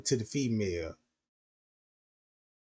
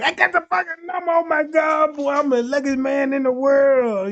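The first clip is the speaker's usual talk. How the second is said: high, loud fast celebrating; jubilant shouting; 11 semitones higher, 9 dB louder than usual. Retold or imitated. A man alone in his car after getting the number, loud jubilant shouting. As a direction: shout like you won the lottery. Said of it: "he jumping around that motherfucker like".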